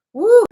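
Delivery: high, loud whoop; happy loud woo; cheer. Real, not acted. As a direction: let out a burst of a whoop. Sudden cheer at stream start.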